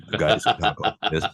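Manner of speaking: put-on low announcer voice; radio DJ voice. Shown as a demonstration. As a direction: drop into a fake radio DJ voice. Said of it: "I can talk like that".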